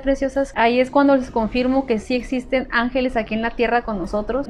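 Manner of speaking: usual speaking voice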